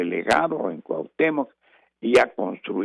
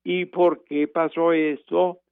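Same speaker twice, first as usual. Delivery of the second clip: slow rising question on "¿Y por qué pasó eso?", lifting on "por"; question intonation; 5 semitones higher, slower than usual. Shown as a demonstration. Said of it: "tienes que decir".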